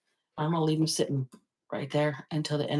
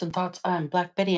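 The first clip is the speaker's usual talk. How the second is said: ordinary talk, nothing marked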